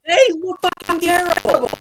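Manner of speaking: high, loud, outraged yell; outraged shout. Real, not acted. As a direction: yell it in disgust. Co-host argues the wings are bad.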